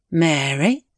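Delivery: lightly voiced aunt, rising question; young aunt's voice. Aunt suddenly addressing Mary, in a younger voice. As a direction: say the name as a gentle question, not querulous. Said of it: "like the voice of the young aunt".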